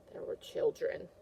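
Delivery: spooky hushed whisper. Acted. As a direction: whisper like a scary secret. Teacher repeats dramatically.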